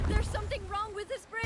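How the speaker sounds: loud, urgent warning cry; alarmed shout. Acted. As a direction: yell it out as the danger hits. Sudden alarm on the bridge.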